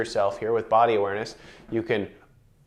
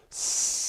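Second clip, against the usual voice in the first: unvoiced hissing S; 6 dB quieter than usual. Shown as a demonstration. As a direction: breathe out a long hiss. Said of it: "you can make an S sound".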